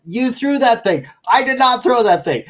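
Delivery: high, loud bickering back and forth; mock argument. Two guests arguing over who threw the meter, mock argument. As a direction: play both sides of a petty on-air spat. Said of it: "They start having an argument".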